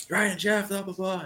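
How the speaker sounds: high, loud imitation of angry yelling; mock yelling. Imitating the big guy going off at Ryan and Jeff, yelling. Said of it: "And he's yelling at them".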